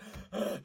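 quick thrilled intake of breath on a high pitch; sharp excited gasp. Retold or imitated. A man recalling a rollercoaster gives a sharp high gasp. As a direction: gasp as if a ride just dropped. Thrill of a theme park ride.

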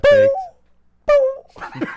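very high, stretched, laughing; laughing squeal. Real, not acted.

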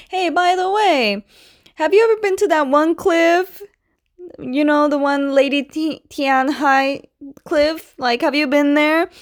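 high, put-on nonchalant questioning; acted casual probing. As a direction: pitch it up, fishing for an answer. Acting Toph casually probing the girl.

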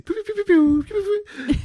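very high pew pew laser noises; pew sound effect. Retold or imitated. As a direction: squeak out rapid pews like a toy blaster. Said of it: "I kept saying".

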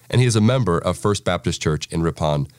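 ordinary delivery

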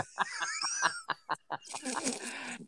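long, soft laughing fit; real laughter. Real, not acted. Host amused by murder and massage, real laughter.

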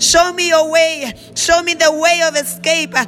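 ordinary talk

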